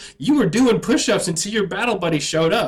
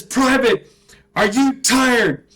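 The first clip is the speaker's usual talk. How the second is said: slow, high, loud sergeant bark; drill sergeant yell; 8 semitones higher, 5 dB louder, slower than usual. Retold or imitated. Drill sergeant scolding the short private, slow and loud. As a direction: spell it out to a recruit.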